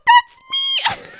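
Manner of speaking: very high, loud squeal; high-pitched mock-innocent yelp; squeaky reply. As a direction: shriek it out like a startled kid. Teller voices herself.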